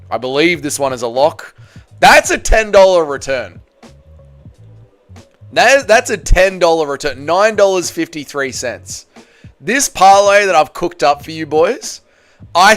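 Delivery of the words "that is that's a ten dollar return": normal talking voice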